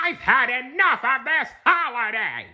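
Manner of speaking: high, nasal-free squid yell; Squidward yelling. A narrator's reading. Narrator voicing Squidward yelling at the holiday. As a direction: bellow it as the fed-up neighbor. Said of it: "yelled Squidward".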